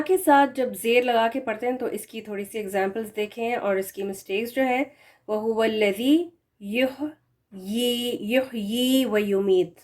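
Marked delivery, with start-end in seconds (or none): 5.3-9.8 slow melodic recitation in a recitation tone; correct Quranic recitation. Shown as a demonstration. Teacher recites the verse as the correct model. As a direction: chant يُحْيِي وَيُمِيتُ with the ya clearly sounded. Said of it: "یحیی ہے correct pronunciation".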